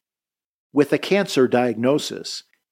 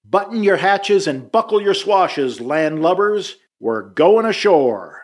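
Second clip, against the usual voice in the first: shouting from crow's nest; 6 semitones higher, 4 dB louder than usual. Retold or imitated. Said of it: "Another shout comes from the crow's nest".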